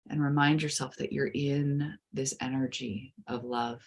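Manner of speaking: soothing guided meditation. Acted. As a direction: ease the listener gently into calm.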